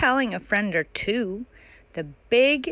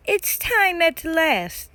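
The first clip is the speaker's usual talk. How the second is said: presenter announcing; 7 semitones higher, 4 dB louder than usual. Acted.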